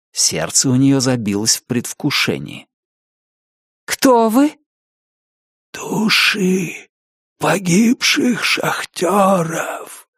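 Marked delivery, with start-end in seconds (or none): 3.9-4.6 put-on eager girl voice. A narrator's reading. Aza questions ghosts. 5.7-10.1 slow, drawn-out ghost voice; eerie whisper from the mist; ghostly whisper. A narrator's reading. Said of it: "Шёпот доносился со всех сторон".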